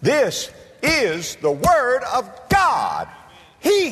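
full-force preaching shout; thundered proclamation. Acted. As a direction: hammer out each word of the climax.

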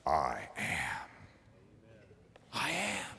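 I am dropped very soft; hushed awe. Acted. Preacher giving Jesus' answer, very soft and hushed. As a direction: breathe I am in awe.

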